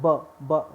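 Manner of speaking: ब, ब said as a crisp short b; isolating the first sound. Shown as a demonstration. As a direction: sound out ब, ब slowly. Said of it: "जो sound निकल रहा है वो ब का".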